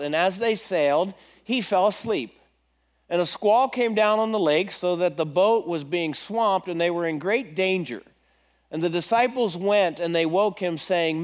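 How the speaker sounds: normal talking voice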